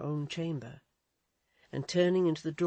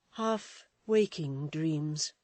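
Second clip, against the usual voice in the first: slow, dazed; much slower than usual. Acted. Edith shaken.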